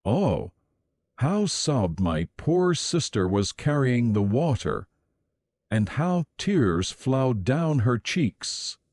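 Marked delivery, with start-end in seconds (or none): none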